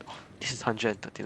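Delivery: ordinary delivery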